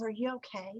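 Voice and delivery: soft, unsmiling concern; dead serious. Retold or imitated. Unsmiling woman checks on her after the fall, dead serious. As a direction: quote her quietly with no trace of a smile. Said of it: "so serious, she never smiled".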